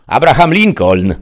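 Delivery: high, loud answer; shouted reply. A narrator's reading. Bandit answers the sheriff from inside, shouting. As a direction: shout back a false name.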